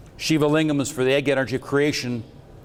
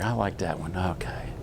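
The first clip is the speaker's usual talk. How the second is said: muttered aside; 8 semitones lower, 8 dB quieter than usual. Real, not acted. Aside while going back a slide.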